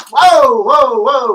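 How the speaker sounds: loud, high sudden whoa; startled whoa. Real, not acted. Streamer startled as his phone slips, loud high whoa. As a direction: yelp as it nearly falls.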